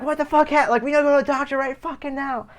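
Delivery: high, urgent retold outburst; mock parental alarm. Alarmed parents rush son to the doctor, mock panic.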